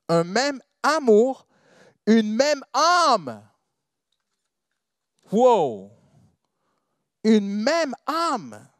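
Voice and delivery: loud, high, drawn-out proclamation; full-force preaching. Real, not acted. Preacher proclaiming scripture on church unity to congregation, full force. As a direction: proclaim each phrase slowly, voice swelling high.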